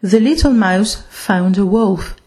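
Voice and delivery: ordinary delivery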